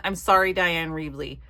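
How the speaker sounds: ordinary talk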